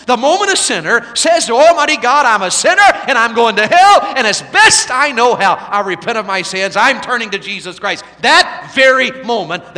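high, loud, ringing revival preaching; full-force preaching. Acted. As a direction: drive the gospel call home to the crowd.

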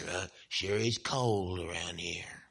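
slow, low grumble about cold; hammed-up weary griping; mock complaining. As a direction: imitate a dreary complainer, dragging the words. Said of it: "we complain about weather".

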